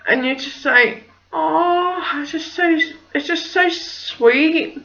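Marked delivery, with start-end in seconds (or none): 1.3-4.8 high, gushing coo; re-enacted doting swoon; melting with affection. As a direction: gush like a mum whose heart melts. Melting mum's reaction, re-enacted.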